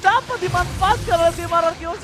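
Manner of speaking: high, loud shouted casting; hyped play-by-play. Real, not acted. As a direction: scream the big play. Live esports play-by-play.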